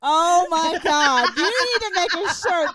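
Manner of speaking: high, loud delighted gush; excited. Real, not acted. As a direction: squeal it out with delight. Delighted by chat comment.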